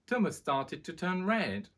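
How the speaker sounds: plain reading voice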